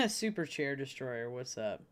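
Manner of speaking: ordinary talk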